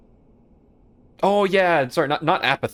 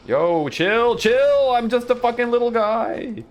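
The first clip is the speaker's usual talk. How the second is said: high, loud comic wail; panicked pleading; 13 semitones higher, 5 dB louder, slower than usual. Real, not acted. Gamer begging the enemy to chill, panicked yelling. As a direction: wail for mercy, climbing and holding it high. Pleads with attacking game enemies.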